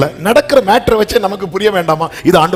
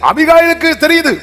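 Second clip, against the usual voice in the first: fiery shout; 12 semitones higher than usual. Acted. Preacher erupts at climax.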